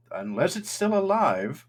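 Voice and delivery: high, slow, drawn-out character voice; Thatch. Acted. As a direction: stretch it out high, as an uneasy afterthought. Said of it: "He goes".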